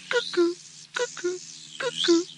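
high cuckoo calls. Acted. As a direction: sing it like a cuckoo clock. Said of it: "I can't do an elephant sound".

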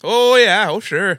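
put-on high, loud sing-song Swedish accent; chirpy mock Swedish accent; bouncing mock Swedish accent. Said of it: "that's my impression of a Swedish person".

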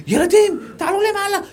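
put-on high, hurried call sending the kids upstairs; fussy wife. Wife sending the children upstairs as guests come, high and hurried. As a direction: shoo the children away before guests arrive.

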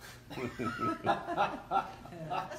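loud burst of laughter; big real laugh. Real, not acted. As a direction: laugh hard after the punchline. Laughs after own punchline.

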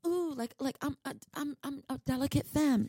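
a hint of a breathy, fragile girl; mock delicate femme. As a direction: play helpless and dainty, lightly. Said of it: "It's giving very much Baby Deer".

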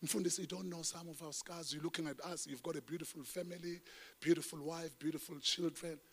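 softly voicing a doubting listener; congregation's quiet complaint. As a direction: murmur it like a hurt churchgoer. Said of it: "you're saying".

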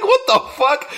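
shocked what the fuck. Retold or imitated. Retelling a movie scene to a friend, mock shocked squeal.

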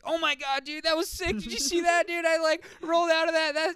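put-on high hyped-up American kid voice; excited dude yelling about a stunt; frat bro. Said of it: "these crazy American kids who are, like, yelling".

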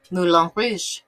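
loud, vaguely French accent; muddled French accent. Shown as a demonstration. Vlogger naming a film, muddled French accent. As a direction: try a French accent and half miss it. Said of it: "I don't know what that accent was. That was kind of French".

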